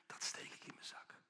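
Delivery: whispered secret aside; sly whisper. Acted. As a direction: let the greedy plan slip out in a whisper. Scheming builder pockets the money. (gefluisterd, stiekem)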